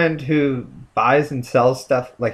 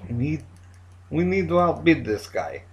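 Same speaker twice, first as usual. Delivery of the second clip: put-on Russian accent; 3 semitones higher than usual. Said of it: "I could talk in a Russian accent".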